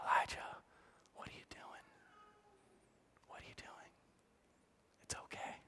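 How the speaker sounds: soft unvoiced whisper, tender; whispered gently. God whispering comfort to a despairing Elijah, hushed. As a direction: hush it like a comforting secret. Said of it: "in the whisper".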